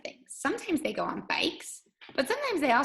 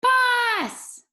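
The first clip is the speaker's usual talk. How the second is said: "Boss" shouted very high and loud, falling; child screaming "Boss" for his dog; shouted name; 14 semitones higher, 8 dB louder than usual. Shown as a demonstration. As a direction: call "Boss" across the yard. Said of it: "with an exclamation mark".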